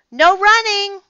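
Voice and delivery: high, loud scolding call; calling after. Acted. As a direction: call the rule after a running lion. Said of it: "Miss Merriweather called after him".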